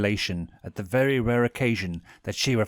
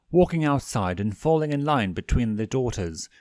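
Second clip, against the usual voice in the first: plain reading voice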